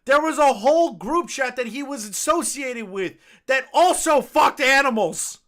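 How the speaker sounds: heated, slow, high-pitched rant; angry outburst. Real, not acted. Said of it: "So aggressive".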